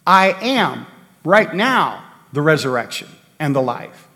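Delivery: I am, right now, with RIGHT NOW leaned on and set apart by pauses; pause, then hit right now firmly; stress on right now. Shown as a demonstration. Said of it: "emphasizing this present time".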